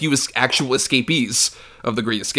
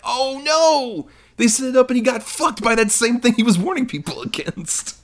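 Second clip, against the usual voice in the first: high-pitched comic lament; exaggerated high cry of dismay; mock dismay; 12 semitones higher than usual. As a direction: wail it in mock horror, laugh creeping in. Mock dismay at the ironic capture.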